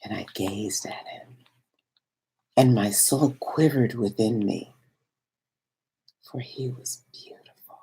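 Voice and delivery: hushed and wondering; awed whisper. A narrator's reading. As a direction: let the words fall away in awe. Awed sight of the beloved.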